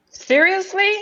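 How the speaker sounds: high, loud incredulous seriously; exasperated disbelief. Retold or imitated. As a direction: throw up your hands in disbelief. Friends keep having babies.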